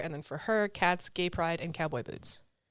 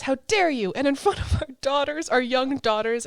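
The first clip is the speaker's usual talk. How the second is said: higher, louder hammed-up outrage; mock outrage; 7 semitones higher, 5 dB louder than usual. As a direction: play the offended wife, dramatically. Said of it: "And she says".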